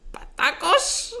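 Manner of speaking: very high-pitched drawn-out surname call; imitated headmaster calling. Headmaster calls student by surname, high comic impression. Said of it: "με φώναζε Πατάκος".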